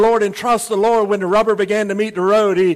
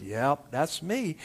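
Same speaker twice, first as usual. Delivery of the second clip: low, slow, soft confirmation; casual Lord, drawn out and quiet; deep, unhurried divine drawl; God's voice from above; 5 semitones lower, 10 dB quieter, slower than usual. Acted. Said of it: "And the voice said".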